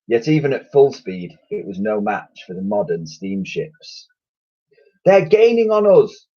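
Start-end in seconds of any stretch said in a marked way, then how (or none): 5.0-6.3 high, loud alarmed yell. Acted. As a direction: warn everyone the ships close in. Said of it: "yelled Elsie".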